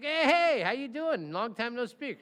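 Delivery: high, loud hammed-up hello; a lawyer happily greeting a client he hasn't heard from in years; mock cheerful greeting. Greeting an old client on phone.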